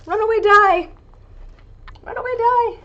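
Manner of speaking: high, loud yell after a runaway die; loud shout. Real, not acted. Die rolling off the table.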